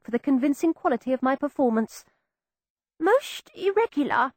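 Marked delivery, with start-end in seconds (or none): 3.0-4.3 murmuring vicar. A narrator's reading. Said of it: "the vicar was murmuring".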